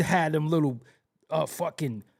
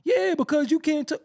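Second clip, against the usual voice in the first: high, laughing mock comprehension; mock understanding; 11 semitones higher, 4 dB louder than usual. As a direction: pitch it up as if it all makes sense. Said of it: "It was like".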